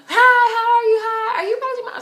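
very high, loud squeal; gushing greeting. Retold or imitated. Friend finally approaching Prodigy's mom, gushing greeting. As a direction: imitate a friend's squealed introduction.

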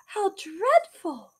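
slow, high shocked reply. Acted. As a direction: gasp out the horror. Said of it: "said Kate".

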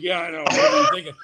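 high, laughing mock exasperation; a hammed-up incredulous brother. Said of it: "And he's like".

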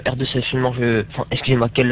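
ordinary delivery

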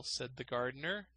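usual speaking voice

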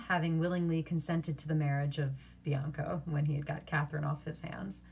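usual speaking voice

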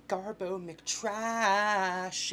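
high, very slow mock announcer call; silly drawn-out name. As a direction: stretch it out like an announcer.

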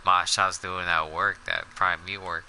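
counting softly under breath; quiet counting. Real, not acted. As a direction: mutter the count to yourself.

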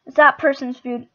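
loud shout, punch THAT; indignant shout. Real, not acted. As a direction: protest loudly. Indignant outburst over food.

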